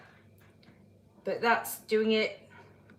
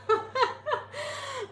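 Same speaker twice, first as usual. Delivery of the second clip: real laughter; 14 semitones higher than usual. Real, not acted. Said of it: "It's so funny".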